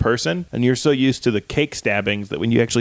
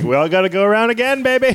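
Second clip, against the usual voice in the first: high, louder teasing voice; cheeky creep; 10 semitones higher, 3 dB louder than usual. Acted. Conspirator urging another round, high and sleazy. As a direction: croon it like a sleazy lover.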